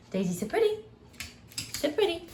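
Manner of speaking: high coaxing command to a dog. Real, not acted. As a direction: coax the dog to sit up.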